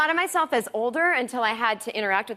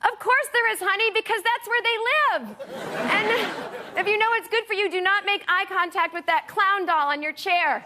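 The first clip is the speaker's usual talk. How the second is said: much higher, sugary mock-reassuring mother voice; mock sweet mom; 8 semitones higher than usual. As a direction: comfort the child brightly while scaring her. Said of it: "I'd be like".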